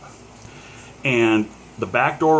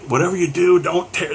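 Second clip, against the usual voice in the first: higher-pitched acted plea; urgent plea; 7 semitones higher than usual. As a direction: urge the ticket taker, pitched up. Re-enacts pleading with ticket taker.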